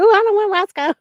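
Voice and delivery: high, loud, whiny mock buyer turning the bear down; mock customer voice.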